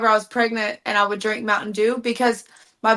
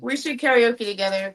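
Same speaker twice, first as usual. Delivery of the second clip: normal talking voice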